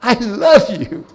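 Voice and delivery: preacher demonstrating a daily prayer, slow and heartfelt; heartfelt declaration. Shown as a demonstration. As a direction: say it like a fervent prayer. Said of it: "that you ought to say to every day to God".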